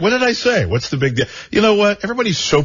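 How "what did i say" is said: ordinary talk, nothing marked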